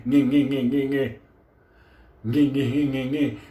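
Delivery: rhythmic engine-cranking noise; imitated failing ignition. Friend turns the key in a dead car, imitated cranking. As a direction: imitate a starter motor turning over in vain. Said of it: "put the key in the fucking car".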